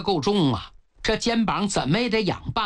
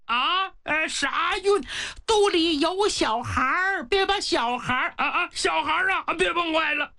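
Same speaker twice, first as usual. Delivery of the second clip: confused simpleton; 6 semitones higher than usual. Acted. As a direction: puzzle it out like a simple man. Pang Hu confused about pregnancy. (傻大个儿的糊涂念叨)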